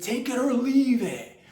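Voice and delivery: very high, loud, slow ultimatum; mock ultimatum. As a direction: stretch it out like a final offer. Haggling demand peaks.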